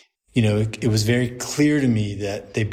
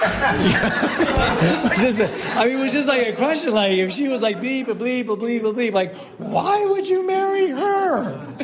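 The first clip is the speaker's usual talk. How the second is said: laughing; 13 semitones higher than usual. Real, not acted. Priest recalls his blunt question.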